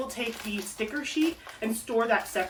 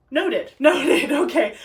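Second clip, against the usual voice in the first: louder, higher, laughing through the words; 5 semitones higher, 7 dB louder, slower than usual. Real, not acted. Crafter noticing the matching sizes, laughing. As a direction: chuckle at your own note.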